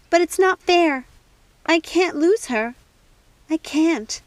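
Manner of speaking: high, loud, pleading; anguished protest. Acted. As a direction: cry out against the loss. Snow protesting Circe's death.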